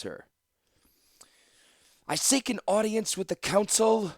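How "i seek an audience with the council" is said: high, loud formal demand; raised, ringing proclamation; yelled. A narrator's reading. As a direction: call out to be heard at the gate. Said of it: "she yelled".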